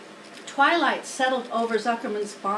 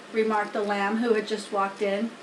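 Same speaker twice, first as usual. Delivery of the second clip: usual speaking voice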